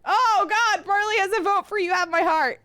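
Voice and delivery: high, loud and overwhelmed; mock-panicked shout. Streamer facing a romance choice, high panicked shout. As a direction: wail the dilemma in mock alarm. Reacting to viewer's vote.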